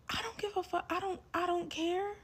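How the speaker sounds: very high, soft little voice; singsong indifference. Retold or imitated. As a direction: brush it off in a tiny high voice. Mock reply to his repeated warnings.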